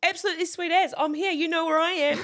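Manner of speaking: thrilled and trying to sound available; eager excitement. Retold or imitated. Junior receptionist answering the boss, bubbly eager excitement.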